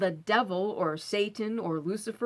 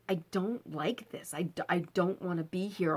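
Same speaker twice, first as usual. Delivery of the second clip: scared whisper; 2 semitones lower, 4 dB quieter than usual. Retold or imitated. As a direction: breathe it out, afraid to be heard. Scared inner plea in haunted house.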